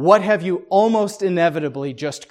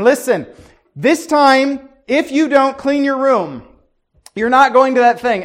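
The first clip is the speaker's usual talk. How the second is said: stern parent; 7 semitones higher, 7 dB louder than usual. Acted. Parent voiced giving firm warning.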